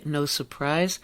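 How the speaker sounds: ordinary delivery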